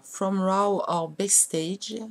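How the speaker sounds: slow, careful English product name in a French accent. Real, not acted. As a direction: sound out the English title with a heavy French accent. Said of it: "avec mon accent super génial en anglais". (nom anglais lu lentement avec un fort accent français)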